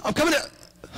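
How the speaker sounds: high, eager hero shout; rushing to the rescue; hammed-up superhero cry; heroic call. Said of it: "He'd go running around the corner".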